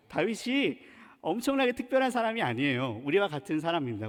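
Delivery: ordinary talk, nothing marked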